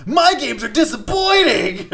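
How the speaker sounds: very high, loud, stretched cartoon yell; Spencer doing Sonic. Mimicking a friend's impression, high loud parody. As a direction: belt it high, dragging the last word. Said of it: "impression of Spencer doing a Sonic impression".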